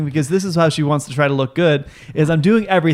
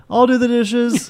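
mock sweet mom; 8 semitones higher, slower than usual. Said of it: "Mom's like".

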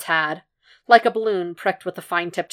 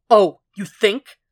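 loud, slow, biting sarcasm; angry retort; 4 semitones higher, 8 dB louder, slower than usual. A narrator's reading. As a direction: round on him, drawing out each word. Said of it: "Jack rounded on him".

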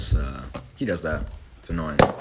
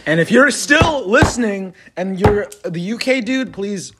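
slow, loud call to a listener; loud callout; 3 semitones higher, 6 dB louder, slower than usual. Real, not acted. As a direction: call out slowly to the absent fan. Calling out to listener.

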